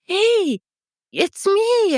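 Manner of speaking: high-pitched call to be recognised; acted excited hello; insistent greeting. Repeats her call to younger self.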